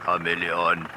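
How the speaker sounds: deep, low tracker's voice; one word, way down low. Retold or imitated. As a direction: drop right down into a deep bass. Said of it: "he had a voice deeper than James Earl Jones's".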